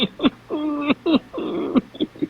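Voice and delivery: high, crying laugh; real laughter you can't stop; laughing till crying; helpless laughter. Real, not acted. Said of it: "I'm crying, laughing at the same time".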